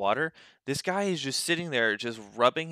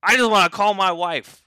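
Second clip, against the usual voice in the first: louder, higher imitated shout; mock yell; 6 semitones higher, 6 dB louder than usual. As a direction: shout it again like the stubborn guy. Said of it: "and he goes".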